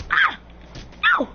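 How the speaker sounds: ordinary talk, nothing marked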